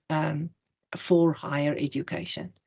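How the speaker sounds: ordinary talk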